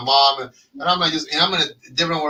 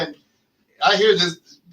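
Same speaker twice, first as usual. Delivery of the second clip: normal talking voice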